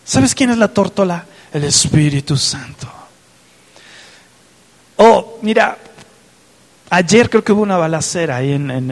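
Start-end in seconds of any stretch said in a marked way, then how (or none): 1.5-2.9 soft unvoiced whisper; whispered answer. Preacher revealing to congregation in a whisper. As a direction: breathe the answer like a secret.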